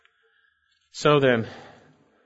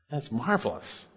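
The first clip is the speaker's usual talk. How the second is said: quiet awe; 8 dB quieter than usual. Real, not acted. Pastor marvels at full pardon.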